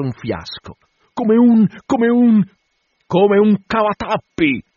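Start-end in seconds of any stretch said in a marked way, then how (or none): 1.1-2.4 very high, loud, stuck on the word; frantic high-pitched stutter; loud stammering. A narrator's reading. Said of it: "balbettava forte cercando la parola". 3.1-4.6 loud, high shout supplying the word; ringing high exclamation; triumphant cry. A narrator's reading. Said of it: "gridò Acab".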